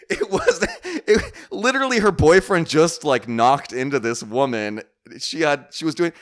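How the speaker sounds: high, loud, laughing disbelief; incredulous. Real, not acted. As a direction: protest the absurdity, pitch way up. Disbelief at the woman's nerve.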